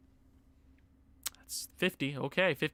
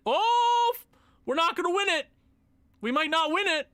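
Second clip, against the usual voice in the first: alarmed worry; 9 semitones higher, 4 dB louder than usual. Real, not acted. Trophy race slipping.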